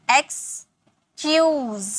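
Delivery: "एक्सक्यूज" slowly in two parts, ex, then kyooz; split syllables. Shown as a demonstration. Teacher modelling "एक्सक्यूज" for students, slow and split in two. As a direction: break "एक्सक्यूज" after ex and draw out kyooz. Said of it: "पहले आप इसे ई एक्स".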